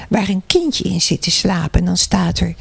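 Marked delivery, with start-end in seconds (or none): none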